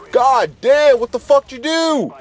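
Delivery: high-pitched, exclaiming disbelief; mock shocked outburst. Handyman Mike coming down to the damage, high mock shock. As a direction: act out a friend's appalled reaction in a high voice.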